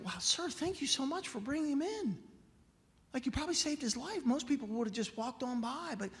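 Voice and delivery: high-pitched, amazed thanks; shocked gratitude. Acted. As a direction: thank him in high-voiced disbelief. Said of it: "she was shocked".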